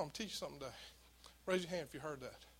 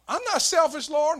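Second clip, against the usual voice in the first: high, loud defensive cry; indignant protest; 11 semitones higher, 14 dB louder than usual. Retold or imitated. Preacher loudly protesting his innocence to the Lord. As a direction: insist you're not selfish, loud and high.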